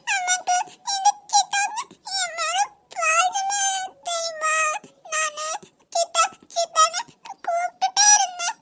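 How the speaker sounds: put-on high, slow tearful child voice; a little girl sobbing her heart out; crying child. Niece sobs to her mother about her uncle.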